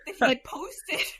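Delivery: crying. Real, not acted. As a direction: voice breaking into a sob. Retelling friend's death, breaks down.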